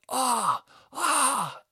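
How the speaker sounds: high, loud mock yell; retold outcry. Outraged neighbour under the window, high loud cries. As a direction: imitate yells from below. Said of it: "I heard them shout up".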